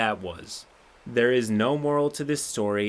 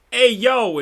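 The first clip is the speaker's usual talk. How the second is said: much higher, loud alarmed shout; 11 semitones higher, 10 dB louder than usual. Retold or imitated. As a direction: yell it to start the escape. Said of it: "until G M shouts".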